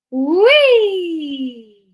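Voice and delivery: loud, high swooping wee; long wee that soars up and slides down; playful vocal whoop. Shown as a demonstration. Teacher making a sound for children to copy, swooping whoop. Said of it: "we make a sound either vocally".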